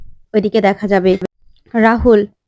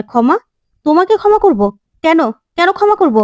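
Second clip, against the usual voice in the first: rising, indignant, higher pitched questions; husband refusing to forgive his wife's sister; angry disbelief; 5 semitones higher than usual. A narrator's reading. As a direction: throw the word back at her in outrage. Husband angrily rejects pleading Titli.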